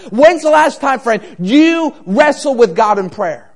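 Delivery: insistent sermon delivery, building in force; impassioned preaching. Real, not acted. Preacher challenging friends about their prayer life, impassioned preaching. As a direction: press each question home to the congregation.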